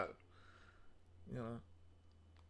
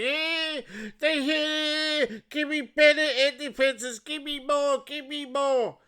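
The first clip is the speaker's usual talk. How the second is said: whiny mock crying; 9 semitones higher than usual. Mocking Ukraine begging Europe for weapons, whiny high voice. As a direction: mimic a begging, whimpering Ukraine, pitched high. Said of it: "they go crying to Europe".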